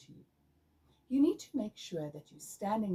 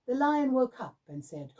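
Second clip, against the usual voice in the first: higher and louder narration; lively storytelling; 7 semitones higher, 8 dB louder than usual. Shown as a demonstration. Teacher reading the lion story to students, lively. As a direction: tell it like an exciting story. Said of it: "For example".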